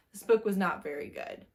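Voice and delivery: ordinary talk, nothing marked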